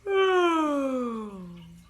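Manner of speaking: big loud sleepy yawn; a big final sleepy yawn; cozy goodnight yawn; good night yawn. Shown as a demonstration. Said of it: "yawn a good night yawn".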